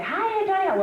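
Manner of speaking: high, loud cheery hello; bright phone greeting. Retold or imitated. Calling home and greeting her aunt brightly. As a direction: sing out the hello to your aunt.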